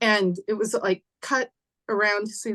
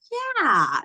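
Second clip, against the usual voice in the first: put-on high-pitched, pleased child's yeah; retold childlike delight, pitched up; pleased little boy; 7 semitones higher than usual. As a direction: imitate a delighted small boy. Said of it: "he was very pleased".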